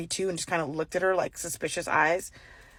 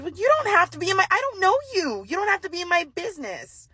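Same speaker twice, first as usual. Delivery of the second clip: fast, much higher, fed-up comeback; exasperated retort; 11 semitones higher than usual. Retold or imitated. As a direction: rattle it off high and quick, done with her.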